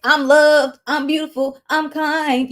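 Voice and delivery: loud, high, pumped-up affirmations; energetic affirmations. Shown as a demonstration. Host hyping herself up for the channel, loud and energetic. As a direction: shout them out like a hype cheer. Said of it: "some stuff on there where I'm like".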